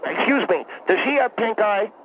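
ordinary talk, nothing marked

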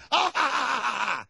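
high shouted laugh. Real, not acted. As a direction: cackle at the joke. Laughs at own joke.

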